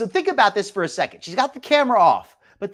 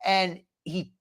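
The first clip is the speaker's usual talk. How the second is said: normal talking voice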